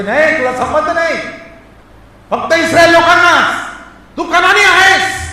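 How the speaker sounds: emphatic, high-pitched dismissal; forceful rebuff. Acted. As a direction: turn them away loudly. Acts out the rebuff.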